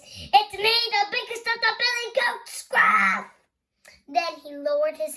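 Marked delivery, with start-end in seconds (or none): 0.0-3.3 loud, booming big goat; loud strong voice. Acted. Big goat answers the troll, loud and strong. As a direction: announce yourself like the biggest goat. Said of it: "in a loud, strong voice".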